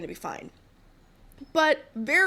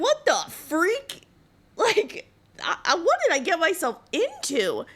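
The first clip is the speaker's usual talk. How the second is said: much higher, louder mock astonishment; shocked disbelief; 8 semitones higher, 4 dB louder than usual. Housemaid reacts to the wife's meltdown in high shocked disbelief. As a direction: squeak out stunned disbelief.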